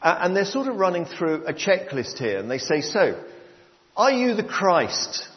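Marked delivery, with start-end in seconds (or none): 2.8-5.2 high, slow probing question; questioning priests. Retold or imitated. Priests check John's authority, high insistent questioning. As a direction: press the question like an interrogator.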